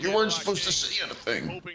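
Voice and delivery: mock sneaky villain. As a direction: tease like a caught-out plotter. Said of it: "He's like".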